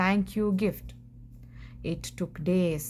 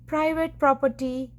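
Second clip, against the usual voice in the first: higher, sharp warning yell; grumpy cactus yelling a warning; 6 semitones higher than usual. Acted. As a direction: bark at the intruder to back off.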